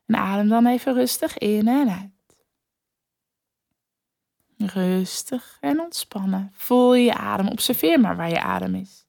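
guided breathing. Acted. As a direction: guide the listener to relax. Leads a breathing exercise.

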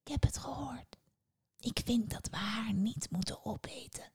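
soft, breath-only whisper; hushed confidential answer; whispered reply. A narrator's reading. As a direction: whisper back in secret. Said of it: "De ander fluisterde".

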